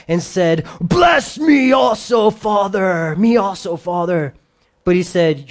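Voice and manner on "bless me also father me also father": high, loud, slow pleading wail; a son begging his father through tears; shouting, anguished plea; bitter cry. Acted. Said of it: "he cried with an exceedingly great and bitter cry".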